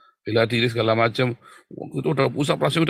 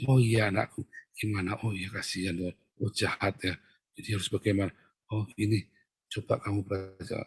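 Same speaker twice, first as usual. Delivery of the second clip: soft, lower fatherly tone; gentle counseling; 4 semitones lower, 6 dB quieter than usual. Acted. Counselor to an ordinary member, soft sympathy. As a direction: soothe them quietly, in short sympathetic phrases. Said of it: "kamu rakyat jelata, saya ngomongnya".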